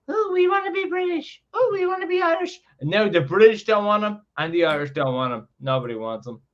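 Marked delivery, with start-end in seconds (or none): 0.1-2.6 much higher, whiny mock voice of a flip-flopping Northern Ireland. Mocking Northern Ireland as a fickle child to his mates, much higher whine. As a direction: squeak it out like a fickle child who can't decide. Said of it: "Northern Ireland used to be like".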